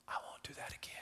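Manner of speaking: whispered private promise; whispered resolve. Pretend. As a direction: breathe it to yourself. Inner voice, private vow.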